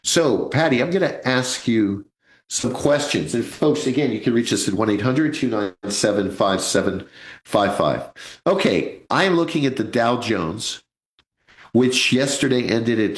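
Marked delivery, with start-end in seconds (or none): none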